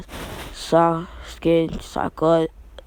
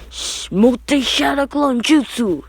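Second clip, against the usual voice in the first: jutsu battle cry; 7 semitones higher than usual. Acted. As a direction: stretch the technique name like an anime hero. Naruto casts a thousand clones.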